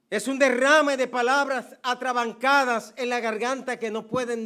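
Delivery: loud, high, fervent pulpit delivery; impassioned sermon climax; full-force preaching. Acted. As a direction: preach it out with rising passion. Preaches the prodigal's embrace.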